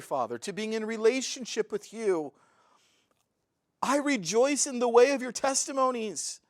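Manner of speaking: growing ecstatic praise, pitch climbing; fervent rejoicing. Real, not acted. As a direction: pour out heartfelt joy to the Father, rising. Preacher's fervent rejoicing before God.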